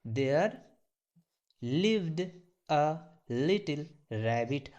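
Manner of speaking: slow, careful English words; slow model reading. Shown as a demonstration. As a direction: draw each word out for the class. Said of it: "कैसे pronunciate करेंगे sir". (धीमा, साफ़ उच्चारण)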